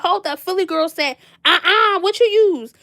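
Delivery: high and loud "uh-uh, what you use"; mock a shocked home cook; imitating an amazed cook.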